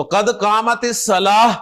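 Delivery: slow قد قامت الصلاح ending in H; pronounced with H. Shown as a demonstration. Teacher correcting how takbeer is said, slow الصلاح with final H. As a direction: slowly close الصلاح on an H, no T. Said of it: "ہا کے ساتھ پروناؤنس کریں".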